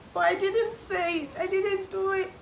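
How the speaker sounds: high, crying plea of innocence; mock crying. Imitating a crying young person denying blame. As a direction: whimper it like a scolded child. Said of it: "You're there crying like".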